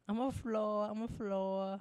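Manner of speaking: high, loud put-on silly voice; imitating Marina. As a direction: quote her goofy line in a squeaky mock voice. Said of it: "That's my favorite thing she's ever done".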